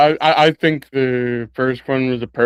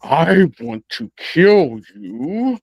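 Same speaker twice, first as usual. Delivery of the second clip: slow mock menace; 4 semitones higher, much slower than usual. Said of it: "what he really means is".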